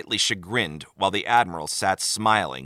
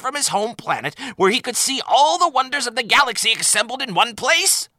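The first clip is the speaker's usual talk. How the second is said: much higher and louder, climbing to a shrill peak; building exasperated rant; 14 semitones higher, 5 dB louder than usual. Acted. Admiral ranting to Sisko about his vacation, rising to a peak. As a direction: rant ever higher until out of breath. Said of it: "The Admiral heaved".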